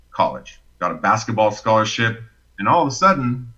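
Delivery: ordinary talk, nothing marked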